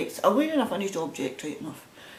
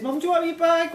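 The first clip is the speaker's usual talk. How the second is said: put-on much higher, louder little-girl voice; daughter's voice; 11 semitones higher, 6 dB louder, faster than usual. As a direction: squeak it up like the daughter asking. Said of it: "So she said".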